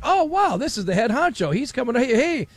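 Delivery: high, thrilled on-air welcome; mock awe, excited. Retelling hosts spotting the head honcho, excited. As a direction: gush as if a bigwig just arrived.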